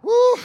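loud, piercing "woo"; sudden exclaiming whoop; high whoop. Real, not acted. Second whoop after exclaiming.

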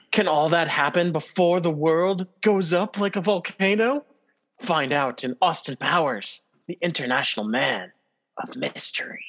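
trailer narrator closing line; movie trailer voice. Acted. Trailer-style plot ending.

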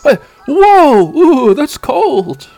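high, loud scoff turning into a shocked yelp; scoffing then startled. Acted. Tai doubting Sora until snow hits, loud yelp. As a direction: brush it off, then get hit by cold. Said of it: "And Tai's like".

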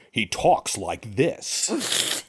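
put-on drawn-out, overdone American accent; lightly mock a bad American accent, very slow. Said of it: "it's like".

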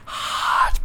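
huge voiceless whisper, stretched out for comic hype; forceful whispered hype. Pretend. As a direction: push out each word hard on pure breath. Hyping the story to come.

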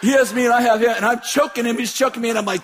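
ordinary talk, nothing marked

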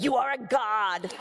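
slow, mock-grand declaration; grand proclamation. Author telling gamers about world building, mock-grand proclamation. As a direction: proclaim it slowly, with playful grandeur. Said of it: "she's like".

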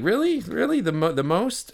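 very high-pitched, disbelieving questions; retold sarcastic squeal of doubt; mock incredulity. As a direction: challenge a braggart with exaggerated disbelief. Retelling his incredulous retort to braggart.

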